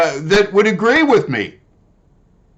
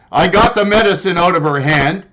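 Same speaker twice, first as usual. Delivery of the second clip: normal talking voice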